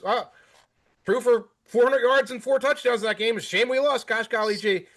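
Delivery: high, aw-shucks humble brag; fake wholesome. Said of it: "Burrow just".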